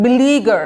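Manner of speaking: "বিলিগার" with a long middle ee; drawn-out syllable. Shown as a demonstration. Teacher demonstrating the drag for learners, stretched "লি" in "বিলিগার". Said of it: "এভাবে টান দিবেন".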